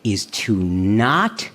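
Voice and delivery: very slow, low punchline; deadpan slow delivery; slow reveal. Shown as a demonstration. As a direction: drag out the punchline. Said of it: "the best thing you can do".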